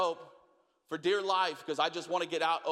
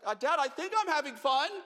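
put-on unsure kid; 9 semitones higher than usual. Kid's unsure reply.

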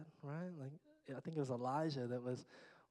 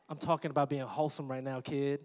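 normal talking voice

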